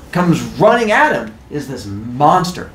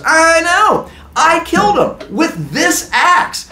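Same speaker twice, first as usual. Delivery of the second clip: high, drawn-out mock yell; yelling proudly; 14 semitones higher, slower than usual. Man to screaming villagers, high emphatic yell. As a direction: holler it over a screaming crowd.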